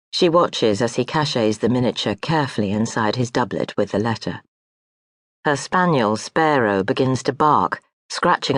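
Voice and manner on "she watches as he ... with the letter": ordinary delivery, nothing marked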